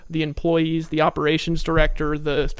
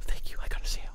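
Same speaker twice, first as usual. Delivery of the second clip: sneaky whisper; 5 dB quieter, faster than usual. Retold or imitated. Retelling his old eager self.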